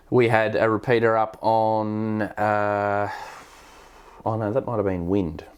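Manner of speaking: normal talking voice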